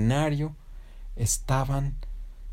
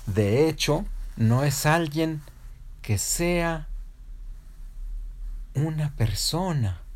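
slow, raised announcement with long pauses; suspenseful announcer; 5 semitones higher, much slower than usual. Acted. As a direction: keep the audience waiting between phrases. Award announcer builds suspense.

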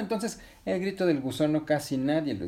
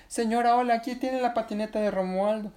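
put-on high-pitched girl calling to a grown-up; high child character voice; little girl; 9 semitones higher than usual. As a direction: pitch it up like a polite, urgent child. Girl hails the boy's mother.

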